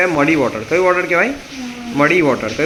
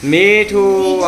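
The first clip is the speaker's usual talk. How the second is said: drawn-out prompt; 3 dB louder, much slower than usual. Shown as a demonstration. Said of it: "ઉદાહરણ આપો".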